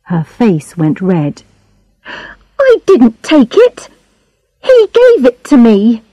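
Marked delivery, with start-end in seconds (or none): 2.6-6.0 put-on high-pitched girl protesting her innocence; young Charlotte, protesting. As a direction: protest in a higher, girlish voice.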